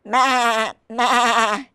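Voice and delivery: attempted goat bleat. A narrator's reading. Reader tries a goat sound for the children, bleating. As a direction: bleat out two goat cries. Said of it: "how to make a goat sound".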